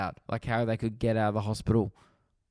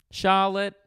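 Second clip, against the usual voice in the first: higher, sing-out roll-call voice; teacher calling roll; 6 semitones higher than usual. Acted. Teacher reading the register to grade one, high roll-call voice. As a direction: call the name out high to the class.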